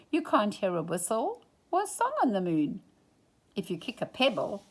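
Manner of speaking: plain reading voice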